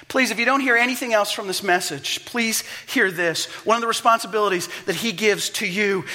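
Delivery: ordinary talk, nothing marked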